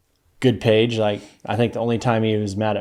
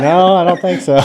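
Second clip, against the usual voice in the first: laughing sheepish reply; 8 semitones higher, 7 dB louder than usual. Retold or imitated.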